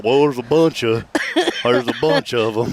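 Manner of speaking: lightly put-on Huntsville accent; a folksy touch of Huntsville speech; Huntsville talk. Shown as a demonstration. Host pokes fun at own town's vocabulary, lightly put-on Huntsville accent. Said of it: "I would do it at Huntsville".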